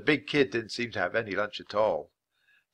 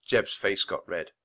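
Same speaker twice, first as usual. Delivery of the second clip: ordinary delivery, nothing marked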